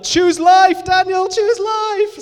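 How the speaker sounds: friends' over-the-top plea to a tearful Daniel, mock wail; mock dramatic plea. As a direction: ham it up like a soap-opera plea. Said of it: "Some people were like".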